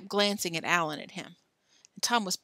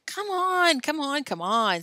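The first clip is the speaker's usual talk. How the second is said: impatient urging; 7 semitones higher, 4 dB louder, slower than usual. Acted. Alan hurries Billy to eat.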